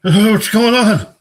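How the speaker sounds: high, loud, slow laughing hello; laughing high greeting. Pretend. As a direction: belt out a giggly greeting way up high.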